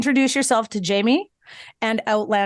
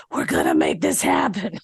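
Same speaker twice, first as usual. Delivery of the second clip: low, croaky mock determination; imitating lost voice. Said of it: "I lost my voice".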